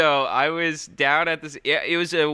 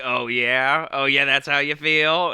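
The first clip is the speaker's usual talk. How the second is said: offended retort; 2 semitones higher, 4 dB louder than usual. Retold or imitated. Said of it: "he's just like".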